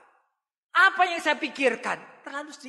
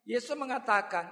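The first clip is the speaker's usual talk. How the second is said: ordinary talk, nothing marked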